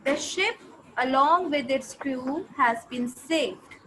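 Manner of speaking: slow, careful reading aloud; slow dictation. Shown as a demonstration. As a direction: read it out slowly for the class. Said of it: "teacher dictating a sentence".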